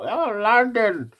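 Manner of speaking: high, drawn-out mock gushing about London; high mocking sing-song impression of a London-obsessed outsider; mocking the out-of-towner. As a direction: parody a visitor who won't stop going on about London. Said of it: "the man then trying to come and tell us about".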